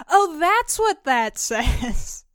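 slow and laughing; laughing realization. Retold or imitated.

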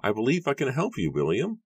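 high-pitched, gentle assurance; high, measured voice rising at the end; slow, soft Matthew. A narrator's reading. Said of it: "Matthew said slowly, softly".

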